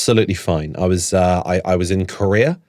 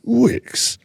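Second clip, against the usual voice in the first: sponsor voiceover; 13 semitones higher than usual. Retold or imitated. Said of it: "sponsored by Wickes".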